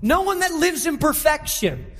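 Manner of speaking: high-pitched, driving sermon delivery; impassioned preaching. Real, not acted. Pastor exhorting the congregation about spiritual pride, impassioned. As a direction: preach it hard, piling up each phrase.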